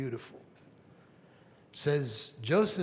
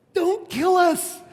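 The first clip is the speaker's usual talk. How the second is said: much higher, much louder, slow, mock begging; hammed-up plea; 13 dB louder, slower than usual. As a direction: wail for mercy, over the top. Brothers beg Joseph for mercy.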